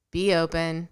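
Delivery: ordinary talk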